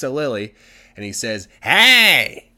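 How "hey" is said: loud, high, drawn-out shout; calling out. Acted. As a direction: holler to get the unicorn's attention. Husband calls out to unicorn.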